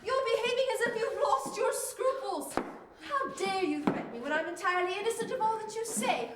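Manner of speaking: loud, high, indignant English outburst; outraged, emphatic dressing-down; indignant rebuke. Acted. Accused guest rebukes her hostess.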